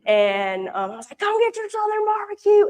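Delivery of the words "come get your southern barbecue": put-on playful Southern accent called out to passers-by at a food stand. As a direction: hawk barbecue in an exaggerated Southern accent. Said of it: "I don't sound like that when I talk".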